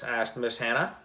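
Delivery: ordinary delivery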